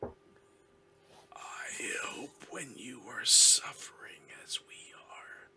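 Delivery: haunting whisper from a suffering captive; eerie other voice. Acted. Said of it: "the other voice says".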